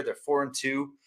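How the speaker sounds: usual speaking voice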